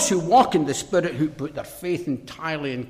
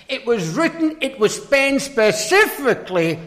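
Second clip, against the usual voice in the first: raised, ringing pulpit delivery; forceful preaching; 7 semitones higher, 8 dB louder than usual. Real, not acted. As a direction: preach it out high and loud.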